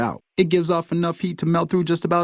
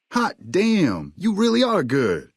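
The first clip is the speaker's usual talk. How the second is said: high, drawn-out admiration; impressed amazement; 6 semitones higher, slower than usual. Acted. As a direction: marvel at their skill. Impressed by recovered shipment.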